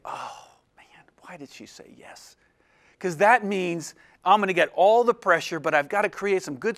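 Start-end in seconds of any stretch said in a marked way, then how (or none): 0.0-2.4 whispered private dismay; inner-voice whisper. Retold or imitated. Husband's secret inner thought, whispered in dismay. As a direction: whisper it like a thought you hide. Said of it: "tucked inside my head is like".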